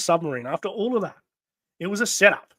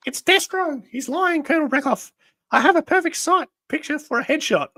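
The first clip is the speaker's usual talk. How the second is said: very high, louder put-on villainess voice; Baroness voice; 12 semitones higher, 5 dB louder than usual. Baroness warning Colonel Breckhoff, high put-on voice. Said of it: "my Baronet voice".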